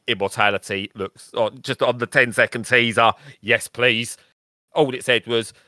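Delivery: usual speaking voice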